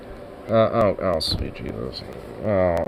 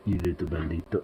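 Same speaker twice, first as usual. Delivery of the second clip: soft lower pet talk to Bandit; cooing at the cat; 5 semitones lower, 6 dB quieter than usual. Real, not acted. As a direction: coo tenderly to a fluffy cat.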